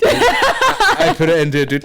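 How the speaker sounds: laughing through words; giggling mid-sentence. Real, not acted. Laughing while telling.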